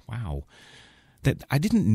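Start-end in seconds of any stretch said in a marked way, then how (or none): 0.1-0.4 breathy whisper; whispered wow. Real, not acted. Stunned host whispers after his own joke. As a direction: whisper it in disbelief.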